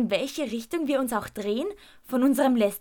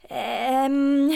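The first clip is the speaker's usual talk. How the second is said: stammering; 4 semitones higher, 5 dB louder than usual. A narrator's reading. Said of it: "stammle ich".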